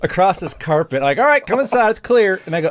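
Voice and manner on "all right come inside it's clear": high, raised call. Retold or imitated. Guard calling the social worker inside, high and raised.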